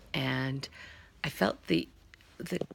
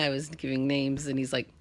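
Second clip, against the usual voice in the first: ordinary delivery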